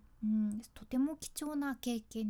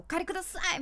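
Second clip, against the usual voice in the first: rushed gabble; 7 semitones higher, much faster than usual. Shown as a demonstration. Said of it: "まくし立ててうわーって言う".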